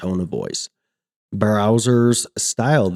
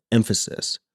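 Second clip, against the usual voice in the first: emphasis stressed with a lowered voice; stressed word; 4 dB quieter than usual. Shown as a demonstration. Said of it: "we stress certain words, as I did there".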